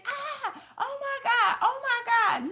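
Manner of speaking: high, squealing mock scream of a kid at her game; imitating screaming daughter. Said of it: "All I hear is".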